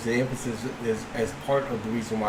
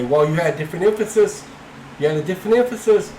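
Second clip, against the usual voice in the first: higher and louder, sarcastic imitation; mocking raised voice; 6 semitones higher, 7 dB louder than usual. Debater mocking an imagined objector, loud sarcastic imitation. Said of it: "You don't say".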